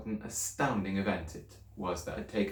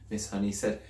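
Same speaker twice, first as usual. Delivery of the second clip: usual speaking voice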